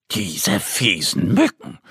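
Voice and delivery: plain reading voice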